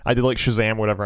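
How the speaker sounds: ordinary delivery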